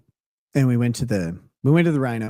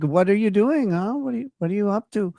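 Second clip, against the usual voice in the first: higher-pitched imitated Colombian accent; hint of a Colombian accent, pitched up; 7 semitones higher than usual. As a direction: mimic a Colombian woman lightly. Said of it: "her accent. She sounded like".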